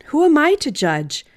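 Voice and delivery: usual speaking voice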